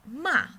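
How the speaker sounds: MA loud, falling sharply; fourth tone. Shown as a demonstration. Teacher demonstrates the fourth tone, sharp fall. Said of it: "the fourth tone".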